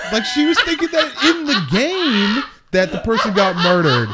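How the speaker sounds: high, loud laughing explanation. Real, not acted. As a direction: tell it while laughing. Laughing while telling.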